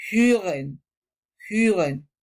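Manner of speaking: hören with rounded lips saying e; German umlaut. Shown as a demonstration.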